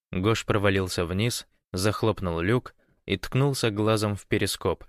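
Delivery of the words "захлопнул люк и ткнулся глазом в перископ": usual speaking voice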